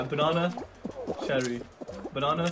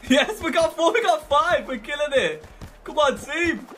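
high, loud hype; excited cheering; 10 semitones higher, 4 dB louder, slower than usual. Real, not acted. Player cheering on teammates, loud excited. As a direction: pump the team up. Team scoring in a match.

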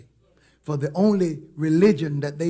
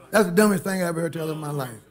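usual speaking voice

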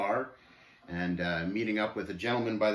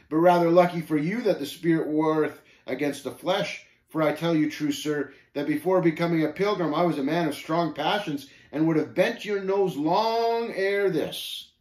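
higher, louder, forceful retort; provoked pilgrim, stern warning; 5 semitones higher, 5 dB louder than usual. Acted. Pilgrim warning Shame of his old passions, stern. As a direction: warn with barely held temper.

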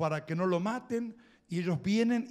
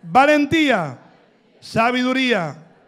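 loud, higher, rousing preacher cry; shouted virtues; 4 semitones higher, 10 dB louder than usual. Real, not acted. Preacher shouts each virtue of Miriam to the congregation. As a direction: belt each word out to rouse the crowd.

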